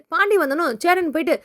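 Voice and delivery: plain reading voice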